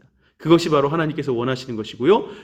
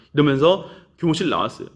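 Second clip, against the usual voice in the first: ordinary talk, nothing marked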